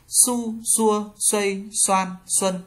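"Su, xua" with a plain hissed s; high, even drill of "xuây, xoan, xuân" on Vietnamese s. Shown as a demonstration. Teacher reading chart two, "Su" with plain Vietnamese s. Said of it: "ét xê giống Việt Nam".

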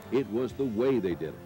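ordinary talk

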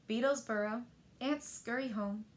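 plain reading voice

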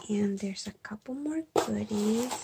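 ordinary talk

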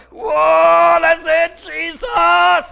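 high, loud retold cry of alarm. Preacher re-enacting a bus sliding on ice, alarmed cry. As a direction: holler out to Jesus as if skidding.

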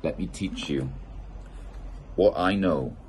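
plain reading voice

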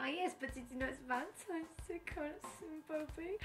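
coy wheedling voice. Pretend.

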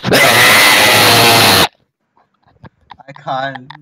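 berserk rage. Shown as a demonstration. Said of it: "This is how you scream".

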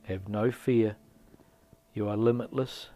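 loud, measured motivational narration; affirmation read. Acted. Guide urging listeners to let go of limiting beliefs, firm affirmation. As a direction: deliver it as a firm, spaced-out affirmation. Reads affirming introduction to meditation.

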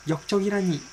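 high, hammed-up cry of dismay; theatrical disbelief. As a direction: wail it, high and stagey. Theatrical outburst at betrayal.

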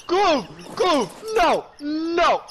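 high, loud screams of panic; panicked yelling. Real, not acted. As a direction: shout in terror at the shark. Shark attacking friend.

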